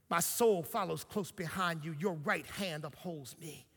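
soft, reverent psalm recitation; tender, loving. Acted. David tenderly talking to God, soft and loving. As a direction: speak softly and lovingly to God. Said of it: "talked about God so tenderly and so lovingly".

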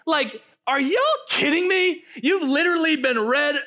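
fervent pastor preaching over music. Acted. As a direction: preach it to the church. Preaching at full force.

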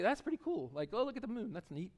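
mock a mildly interested onlooker, light and quiet; casually unimpressed. Preacher imitating a casual stargazer, lightly unimpressed.

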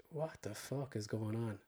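soft, low, baffled mutter; bewildered mutter. Retold or imitated. Waking in a stranger's car, a soft bewildered mutter. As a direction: mutter it to yourself, lost.

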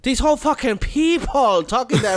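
slow sneering mock voice of a listener. Listener mocking the old hosts, slow and sneering. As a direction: drag it out like a scornful young listener. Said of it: "They're like".

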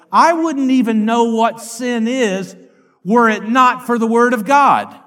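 loud, slow declamation from the pulpit; emphatic preaching. Acted. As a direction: drive the quotation home slowly and loudly. Declaims the apostle's words.

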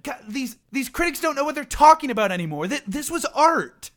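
rising, fast indignant rant; mock-offended actor venting; indignant outburst. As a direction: rush it, wounded pride boiling over. Actor upset by harsh review.

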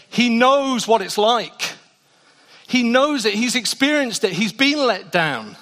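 high, loud preacher in full flight; impassioned preaching. Real, not acted. Preacher encouraging the church that they're not alone, impassioned. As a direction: drive it home to the congregation, loud and urgent.

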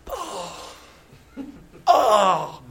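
high, drawn-out pained "oh"; mock groan of being convicted; chastened "oh". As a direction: let out a stung "oh". Acting his reaction to being rebuked.

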